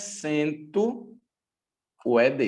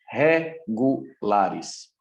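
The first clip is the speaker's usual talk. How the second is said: "regulares" broken into slow, separate syllables; 4 semitones lower, much slower than usual. Shown as a demonstration. Teacher correcting irregular to regular verbs, "regulares" split into syllables. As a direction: spell out "regulares" in measured chunks, re-gu-la-res.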